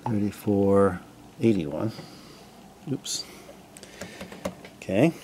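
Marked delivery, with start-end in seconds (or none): none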